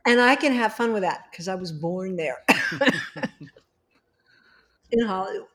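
ordinary talk, nothing marked

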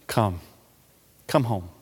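low and hushed, with long pauses; soft, tender calling. Acted. Preacher quoting a hymn's call to come, softly and tenderly. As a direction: call them gently, well below speaking level.

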